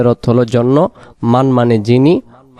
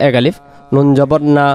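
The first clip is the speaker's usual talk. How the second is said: ordinary talk, nothing marked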